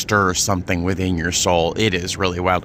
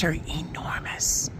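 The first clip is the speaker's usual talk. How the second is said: whisper; 6 semitones higher, 8 dB quieter than usual. Real, not acted. Awed aside about huge guns.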